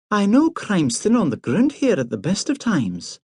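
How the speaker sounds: be briskly no-nonsense; brisk Priscilla. A narrator's reading. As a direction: Priscilla scolding Hamish for lying in bed, brisk. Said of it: "she said briskly".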